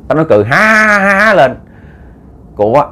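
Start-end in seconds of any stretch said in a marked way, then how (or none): 0.5-1.3 drawn-out, higher-pitched mock laugh; imitated laugh. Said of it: "là cười nha". (giả tiếng cười ha ha kéo dài)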